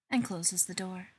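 plain reading voice

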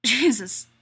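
loud strained grating cry; grated out. Acted. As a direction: force it out through strain. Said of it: "he grates out".

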